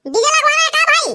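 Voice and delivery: ऊँची, बदली हुई फ़ोन वाली आवाज़ में डीजे वाला; फ़ोन पर बेफ़िक्र डीजे वाला बनकर बोलो; डीजे वाले की आवाज़. Acted. DJ operator on the phone. (दूल्हे के दोस्त से फ़ोन पर बात करता डीजे वाला, ऊँची आवाज़ में)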